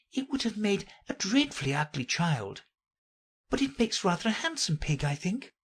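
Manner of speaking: high, soft voice, talking to herself; Alice musing. Acted. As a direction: muse aloud in a light, high voice.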